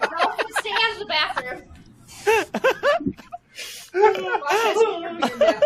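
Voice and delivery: laughing. Real, not acted. Teasing about the wiener beard.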